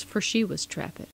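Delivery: ordinary delivery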